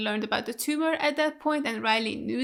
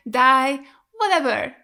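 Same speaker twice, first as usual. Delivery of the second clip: angry outburst; 8 dB louder, slower than usual. Retold or imitated. Telling Riley to leave, louder. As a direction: fling it away at him. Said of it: "And it's just like".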